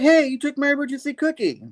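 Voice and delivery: very high mock complaint; high whiny Spidey. Spider-Man losing his cookie to the goose, high whine.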